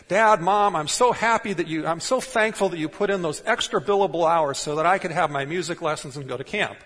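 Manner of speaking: high, loud pretend grateful kid; mock child voice. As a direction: play the impossibly thankful child, hammed up. Imagined child thanking parents.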